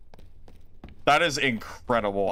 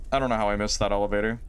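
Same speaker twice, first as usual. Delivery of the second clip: ordinary talk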